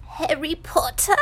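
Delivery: high little house elf; high-pitched creature voice. A narrator's reading. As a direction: squeak it as an awed elf. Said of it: "in such a high-pitched voice".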